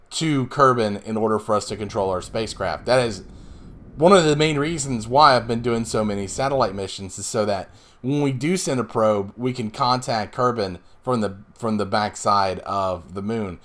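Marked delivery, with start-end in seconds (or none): none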